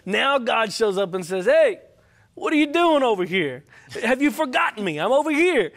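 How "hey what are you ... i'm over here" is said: raised, higher-pitched challenge; insistent preacher's voice of a challenging God; calling out, confronting. Acted. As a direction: confront a runaway who has forgotten you. Acts God confronting the runaway Elijah.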